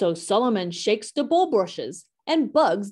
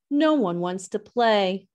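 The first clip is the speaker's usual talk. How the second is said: ordinary delivery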